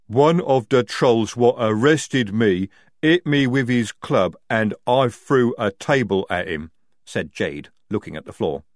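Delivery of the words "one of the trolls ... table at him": loud, lumbering troll voice; slow-witted troll. A narrator's reading. Jade the troll telling of a fight, lumbering. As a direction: plod heavily through the confession.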